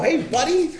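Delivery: high, loud, pumped-up hello; stoked. Retold or imitated. AA regular welcoming the smelly newcomer, stoked. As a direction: greet him like your best pal. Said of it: "Like, he was stoked".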